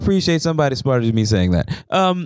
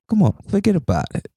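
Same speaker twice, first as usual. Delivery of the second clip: higher-pitched Italian-American mobster catchphrase; Italian mafia accent; 5 semitones higher than usual. Shown as a demonstration. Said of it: "I like to do the accent".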